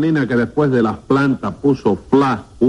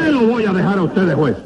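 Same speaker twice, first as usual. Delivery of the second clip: high-pitched outraged retort; indignant disbelief; 7 semitones higher than usual. Acted. Judge answering defendant who wants his seat, indignant. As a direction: throw the suggestion back, pitch raised.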